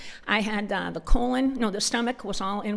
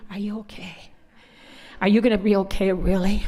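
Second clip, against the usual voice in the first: a hushed, anxious husband voice; worried husband; 3 dB louder than usual. Retold or imitated. Husband doubting his wife can cope, anxious and hushed. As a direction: fret over her, doubting the answer. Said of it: "The way he just said it".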